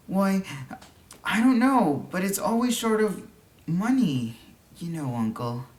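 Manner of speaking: put-on a boy struggling to explain; nervous boy. A narrator's reading. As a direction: blurt it out like a fidgeting boy. Boy fidgeting, hesitant.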